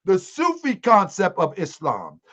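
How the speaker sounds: ordinary talk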